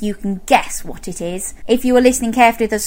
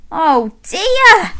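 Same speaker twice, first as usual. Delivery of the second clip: higher, drawn-out mock dismay; playful dismay; 8 semitones higher, 3 dB louder than usual. Storyteller reacting to Mister Bear's bad luck, playful dismay. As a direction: lament it for little listeners.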